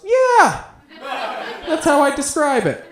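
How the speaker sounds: high, loud and delighted; excited yes. Retold or imitated. The girl cheerfully confirming he fucks like a dad, gleeful shriek. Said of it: "and she goes".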